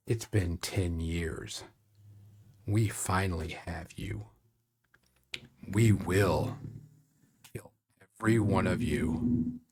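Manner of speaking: masked leader threatening the party; menacing leader. Acted. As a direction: threaten them quietly. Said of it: "push past them and goes".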